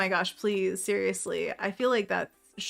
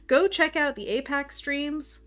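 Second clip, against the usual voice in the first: higher, louder and slow; excited plug; 7 semitones higher, 6 dB louder than usual. Real, not acted. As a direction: sell it to the chat with a big push.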